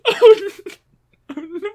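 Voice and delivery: high, loud dramatic oh no; mock dismay. Real, not acted. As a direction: squeal it in mock horror. Dramatic reaction to plot.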